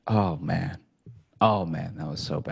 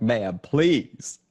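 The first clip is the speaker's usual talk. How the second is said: higher, louder, pleading with the bank not to cancel; half-hearted desperate begging, laughing at himself; mock pleading; 5 semitones higher, 5 dB louder than usual.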